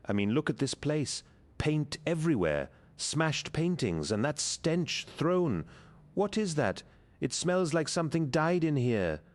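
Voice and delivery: exasperated man, voice climbing high; growing anger. Acted. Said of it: "his anger growing in proportion to her indifference".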